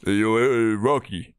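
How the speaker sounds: low slurred Rocky mumble; lightly voiced Rocky Balboa; Sylvester Stallone voice. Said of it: "a Sylvester Stallone impersonation".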